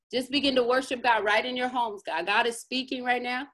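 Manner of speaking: ordinary talk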